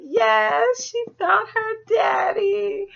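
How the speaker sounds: half-crying joy, shouted; overjoyed. Retold or imitated. Viewer cheering Meg finding her father, teary shout. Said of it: "I was like".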